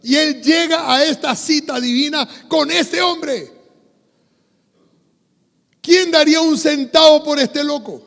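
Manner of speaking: loud, high-pitched impassioned preaching; preaching at full force. Real, not acted. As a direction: preach it out to the whole congregation at full voice. (predicación a plena voz)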